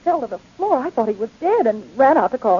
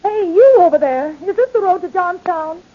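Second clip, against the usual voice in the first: raised, higher voice hailing someone; calling out; 6 semitones higher, 6 dB louder than usual. Acted. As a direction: shout over to ask directions. Hails a stranger for directions.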